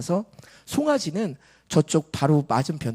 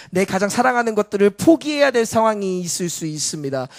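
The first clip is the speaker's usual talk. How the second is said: loud, raised sermon voice building in intensity; full-force preaching; 5 semitones higher, 7 dB louder than usual. Real, not acted. Preacher listing loved ones to give up, full-force preaching. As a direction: build the list with mounting force.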